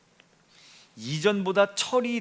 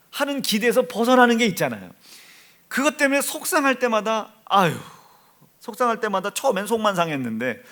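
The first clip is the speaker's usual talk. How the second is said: fervent preaching; 11 semitones higher, 7 dB louder than usual. Acted. Preacher building to full force.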